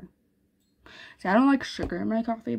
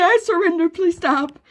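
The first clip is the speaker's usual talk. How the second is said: pleading; 9 semitones higher, 7 dB louder than usual. Acted. Enemy begs to stop.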